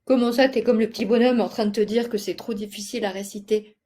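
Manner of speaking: loud, fast feigned protest; mock indignation. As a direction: playfully scold a discouraged pupil, quick and loud. Feint de gronder l'élève découragé. (fausse indignation, vite et fort)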